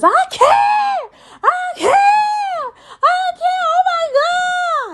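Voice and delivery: imitating a meltdown, sobbing shout; hysterical shrieking. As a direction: shriek it like someone losing her mind. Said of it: "loses her rabid mind".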